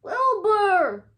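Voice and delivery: loud high call of the name; shout. Acted. Charles shouting to sleepy Wilbur, loud call. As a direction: yell his name to wake him. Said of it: "shouted Charles".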